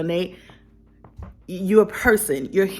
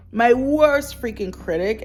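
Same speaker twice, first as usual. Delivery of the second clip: ordinary talk, nothing marked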